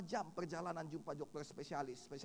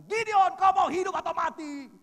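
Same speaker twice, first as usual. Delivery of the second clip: loud stern yell, much higher; father's bark; 13 semitones higher, 17 dB louder than usual. Retold or imitated. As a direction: bellow it like a strict father demanding an answer. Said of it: "sekali itu dia bentak saya". (bentakan ayah yang keras)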